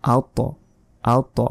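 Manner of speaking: আ ত with a heavy t and echoing release; thick, jolted "at-to" for আ ত; bounced letter. Shown as a demonstration. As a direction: push off the ত in আ ত. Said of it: "ধাক্কা দিয়ে পড়তে হয়".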